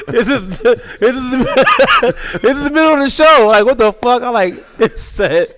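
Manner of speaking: words squeezed out, high and loud, through real laughter; laughing through words. Real, not acted. Recalling the note to the host, laughing hard. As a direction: try to talk but keep cracking up. Laughing while telling the story.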